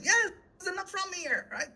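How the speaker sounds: exasperated retort. Retold or imitated. Answering settlers surprised by forest fires, exasperated. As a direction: stretch it out high, as if stating the obvious.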